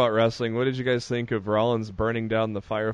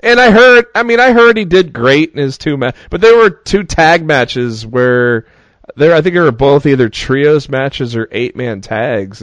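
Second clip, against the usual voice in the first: loud; 12 dB louder than usual. Real, not acted. Excited rant about Lesnar.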